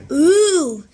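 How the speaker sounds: loud delighted ooh; thrilled to spot candy; excited. Acted. Rip spots candy.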